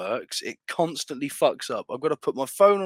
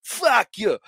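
mock yelling at bear; 5 semitones higher, 6 dB louder, slower than usual. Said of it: "starts pushing it underwater like".

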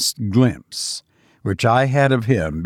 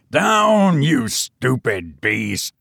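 a hammed-up angry command to the beast; roared; much slower than usual. A narrator's reading. Cousin ordering the cat down, mild mock roar. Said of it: "he roared".

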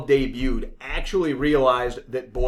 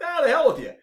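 scoffing brush-off, pitched way up; dismissive; 11 semitones higher, faster than usual. Retold or imitated. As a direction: wave them off with a high scoff. Dismissive quote of player.

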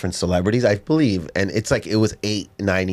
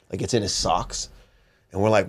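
normal talking voice

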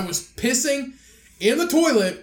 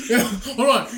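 ordinary talk, nothing marked

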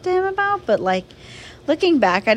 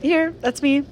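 high, chirpy attendance reply; mock schoolkid saying here; answering roll call; 6 semitones higher than usual. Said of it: "like when they were calling, like, attendance".

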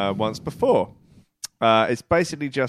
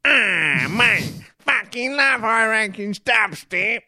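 higher, slow mock voice of a drunk lad; imitating the drunk rowdy mate; 9 semitones higher, 3 dB louder, much slower than usual. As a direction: ham up your drunk friend gushing. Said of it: "should probably do the voice".

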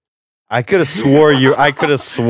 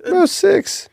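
mocking. Real, not acted. Mocking friend's shrinking claim.

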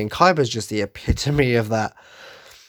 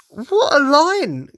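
pitched way up, slow and admiring; impressed; 13 semitones higher, 4 dB louder, much slower than usual. Real, not acted. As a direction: savour it in awe. Admiring a cool line.

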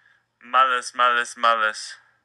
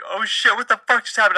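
much higher, startled oh shit; startled outburst; 10 semitones higher than usual. Retold or imitated. Owner startled as his dog bumps an old lady, high surprised outburst. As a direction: yelp it out, caught off guard, pitched way up. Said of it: "I'm like".

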